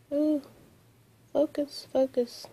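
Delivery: softly coaxing a stubborn phone; coaxing the camera. Real, not acted. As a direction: urge the camera to focus under your breath.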